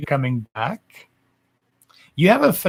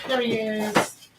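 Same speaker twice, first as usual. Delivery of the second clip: cooing greeting; 9 semitones higher, 4 dB quieter, slower than usual. Real, not acted. Greeting partner arriving, cooing.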